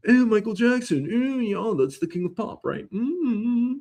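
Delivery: high-pitched, drawn-out mock agreement; mocking imitation. Comic mimicking [name]'s defenders, mocking. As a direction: mimic a smug objector in a high voice. Mocking fans of king of pop.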